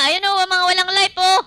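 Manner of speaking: loud, high cheering over the kill; excited cheering. Real, not acted. (masiglang sigaw ng tuwa)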